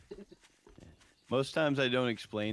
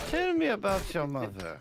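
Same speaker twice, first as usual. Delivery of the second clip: high, loud mock German analyst; pitched-up German therapist accent; 10 semitones higher, 4 dB louder than usual. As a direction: put on a comic German shrink voice. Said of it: "Why are you doing a German accent".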